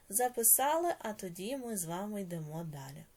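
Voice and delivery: normal talking voice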